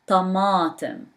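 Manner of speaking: "tamatim" with a thick, heavy emphatic ta. Shown as a demonstration. Teacher correcting how students hear the word. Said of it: "I said ta".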